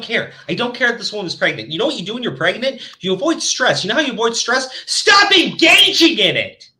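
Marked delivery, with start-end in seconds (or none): 4.9-6.7 higher, slow, punched command; slow emphatic scold. Real, not acted. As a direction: lay it down firmly. Emphatic advice in rant.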